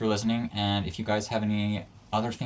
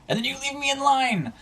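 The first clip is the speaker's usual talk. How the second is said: indignant whine. Retold or imitated. Retold complaint to the guy.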